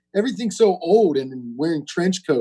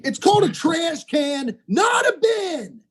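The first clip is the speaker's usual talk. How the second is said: high-pitched indignant mock yell; mock outrage; 13 semitones higher than usual. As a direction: protest in a much higher voice, dragging the end. Mock outburst at British words.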